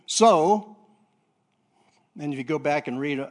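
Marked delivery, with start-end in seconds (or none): 0.1-0.8 "So" drawn out high and loud; stress on so. Shown as a demonstration. Preacher quoting Romans to the congregation, punching "So". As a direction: land hard on "So". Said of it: "that's a big word, isn't it?".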